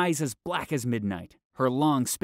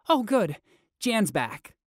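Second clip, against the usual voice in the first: put-on relieved girl; 10 semitones higher, 5 dB louder, slower than usual. A narrator's reading.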